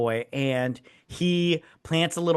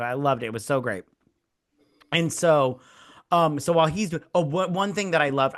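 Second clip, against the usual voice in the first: normal talking voice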